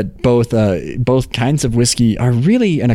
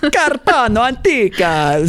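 put-on Italian accent; 11 semitones higher, slower than usual. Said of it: "it's so fun to say".

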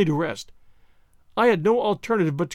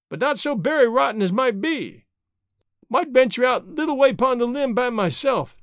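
Jupiter, reasoning; 4 semitones higher, 4 dB louder than usual. Acted. Servant explains the heavy bug to master, higher voice. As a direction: argue your case down as the servant in dialect.

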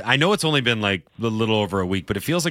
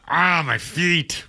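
slow pained groan; mock pain groan; 5 semitones higher, much slower than usual. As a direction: drag it out like sore feet. Mock pain about sore feet.

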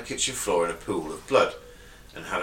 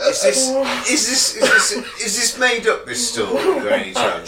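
high, laughing disbelief; laughing incredulous; 12 semitones higher, 4 dB louder than usual. Real, not acted. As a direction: ask it cracking up.

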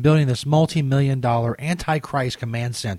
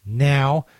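ordinary talk

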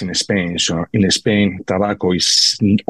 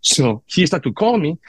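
ordinary delivery

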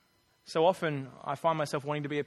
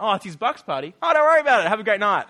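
cheery policeman; 3 semitones higher, 11 dB louder, faster than usual. Retold or imitated. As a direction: imitate a policeman suddenly all friendly, booming. Policeman's sudden friendly change of heart.

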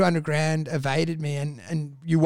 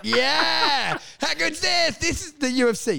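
put-on thrilled kid; 9 semitones higher than usual. Retold thrill at the fight.

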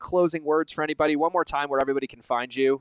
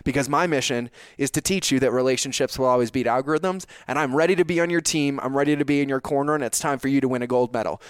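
normal talking voice

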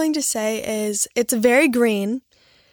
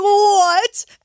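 a sudden high, loud what; shocked what; 9 semitones higher, 8 dB louder than usual. Retold or imitated. Hearing she won, blurting out a shocked what. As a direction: blurt it out in disbelief.

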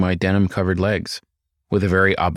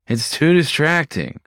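nasal whine; 7 semitones higher, slower than usual. Acted. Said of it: "she whined".

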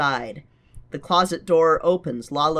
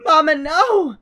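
crying out; 13 semitones higher, 8 dB louder than usual. Acted. Character cries out in dream.

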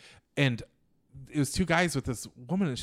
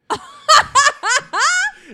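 high, loud cackling laugh; laughing hard at his own story; loud burst of laughter; 16 dB louder than usual. Real, not acted. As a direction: let a big laugh explode out.

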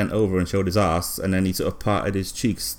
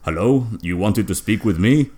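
voicing Figo; 4 dB louder than usual. Figo's voice voiced in story.

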